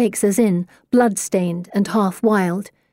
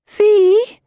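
high, whining call; plaintive, high-pitched and a bit frightened; scared whine; 13 semitones higher, 6 dB louder than usual. A narrator's reading. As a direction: whine the name anxiously. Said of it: "Pippa whines, sounding a bit scared herself".

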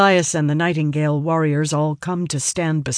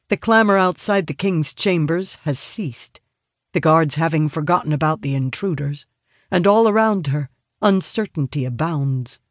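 ordinary delivery, nothing marked

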